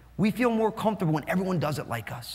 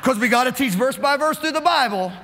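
loud, higher mock preacher voice; mock rigid pastor; 4 semitones higher, 5 dB louder than usual. Mocking a rigid pastor to the congregation, loud mock preacher voice. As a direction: lampoon a rule-bound pastor, booming.

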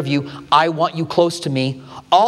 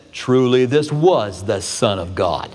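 much lower, slow cowboy-movie drawl; a gruff movie centurion, low and deliberate; John Wayne impression; 7 semitones lower, slower than usual. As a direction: drop low and slow like an old Western star. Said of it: "That's exactly how he said it".

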